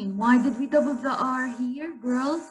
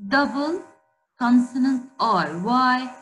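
usual speaking voice

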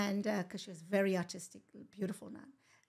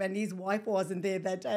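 ordinary delivery

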